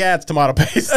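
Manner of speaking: high, loud insistence with a laugh; mock dismissal. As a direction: brush it off, about to crack up. Retold customer insisting, laughing.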